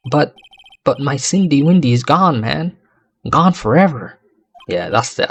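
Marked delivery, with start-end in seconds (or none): none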